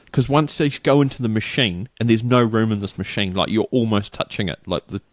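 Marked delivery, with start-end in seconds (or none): none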